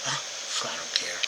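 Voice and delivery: low, soft, careless aside; mock indifference, tossed off quietly; dismissive shrug. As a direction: voice a thoughtless man who can't be bothered. Acting out the thoughtless man.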